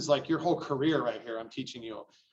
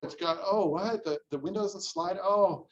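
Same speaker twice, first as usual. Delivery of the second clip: high, fretful mock panic over small defects; panicky client; 4 semitones higher, slower than usual. Buyer reading the inspection report to agent, hammed-up panic. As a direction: whine in alarm about loose doorknobs, pitched up. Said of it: "your client is sitting there going".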